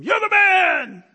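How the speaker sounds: high, loud yell across the field; dad yelling. Retold or imitated. Dad yelling encouragement to his son at the shootout, loud high yell. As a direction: bellow it from the sideline, stretched out. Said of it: "his dad yells to him".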